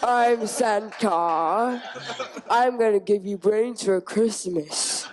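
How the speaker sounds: slow, laughing undead Santa voice; zombie Santa. Acted. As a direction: drag it out like a giggling zombie Santa. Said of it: "let's see your best zombie Santa impression".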